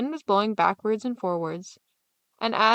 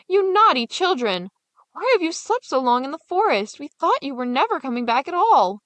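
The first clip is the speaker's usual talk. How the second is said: scolding; 6 semitones higher, 5 dB louder than usual. Acted. Stepmother scolding the returned children, loud. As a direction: tell off the naughty children.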